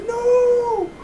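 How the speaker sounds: high shrieked cry of denial; anguished cry. Retold or imitated. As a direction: scream it like you can't believe it. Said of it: "I was like".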